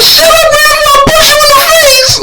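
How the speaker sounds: very high mock angry squeal; mad cousin. Cousin mad at being taunted, high mock squeal. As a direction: screech it like a furious cousin. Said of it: "She got mad".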